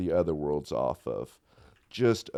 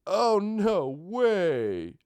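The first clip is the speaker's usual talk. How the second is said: surprised disbelief; 12 semitones higher, much slower than usual. Real, not acted. Disbelief on screen.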